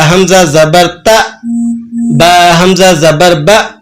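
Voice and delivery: slow हमज़ा ज़बर ता with a glottal catch; jerking sound. Shown as a demonstration. Teacher demonstrating the hamza jerk slowly. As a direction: spell out ता, बा with a catch. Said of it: "एक jerking sound".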